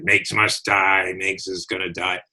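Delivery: slow, low mock menace; nasty voice. Man imitating a threatening caller, slow nasty voice. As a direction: drag it out like a creepy caller, lightly. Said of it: "in a real nasty voice".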